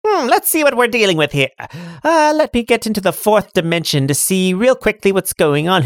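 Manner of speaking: high cackling witch greeting. Acted. GM as the Dimensional Witch, high and gleeful.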